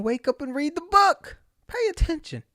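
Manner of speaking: rising squeaky pep talk; high urging. Retold or imitated. Sleepy reader prodding himself to stay awake, high urging. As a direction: push your voice up and prod yourself awake.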